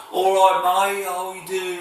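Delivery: high-pitched mock Brummie; Birmingham accent. Shown as a demonstration. Imitating the Brummies for viewers, high Birmingham accent. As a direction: put on a hammed-up Brummie accent, pitched well up. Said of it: "the Birmingham accent".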